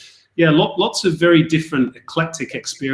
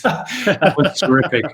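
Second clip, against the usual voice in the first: laughing; 4 semitones higher, slower than usual. Real, not acted. Laughs recalling Cup of Nations.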